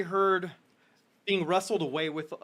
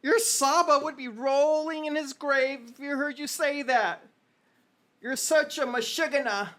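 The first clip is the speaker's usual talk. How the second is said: high, exasperated mother; rising outrage at her son; 8 semitones higher than usual. Acted. Mother scolding her son, high and exasperated. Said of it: "she goes".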